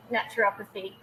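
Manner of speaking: normal talking voice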